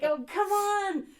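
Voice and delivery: high, loud teasing push; imitated exasperated coaxing; coaxing family. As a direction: coax her like impatient relatives. Family coaxing her to jump.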